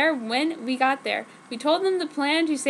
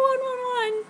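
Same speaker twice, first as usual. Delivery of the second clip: yell; 12 semitones higher than usual. Acted. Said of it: "I yelled".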